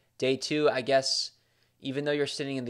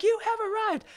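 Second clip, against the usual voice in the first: very high, mock-grand proclamation. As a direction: proclaim it in a sky-high imitation voice. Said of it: "[name]'s like".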